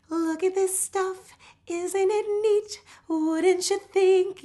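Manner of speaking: high, slow, forward bright tone in the mask; sing-speak it through a smile; smiling voice. Shown as a demonstration. Teacher shows students the smiling voice in the mask. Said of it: "So that smiling voice".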